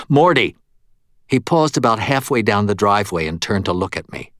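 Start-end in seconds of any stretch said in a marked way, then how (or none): 0.1-0.6 loud, raised call. A narrator's reading. Ghost calls back the departing medium, loud call. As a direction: shout the name after him.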